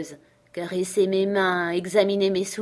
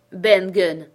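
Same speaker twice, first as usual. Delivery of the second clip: rasping like a rusty lock, loud; a castaway's croak, unsure of himself; hoarse, embarrassed voice; 7 dB louder than usual. A narrator's reading. Marooned man to stranger, hoarse and awkward. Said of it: "d'une voix rauque et embarrassée".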